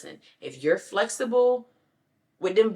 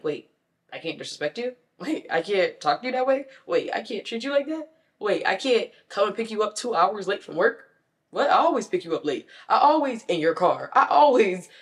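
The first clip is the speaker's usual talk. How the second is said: mocking indignant partner; 3 semitones higher, 5 dB louder than usual. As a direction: play the offended partner who always shows up late.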